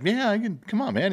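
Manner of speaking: put-on cocky young self. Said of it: "I was like".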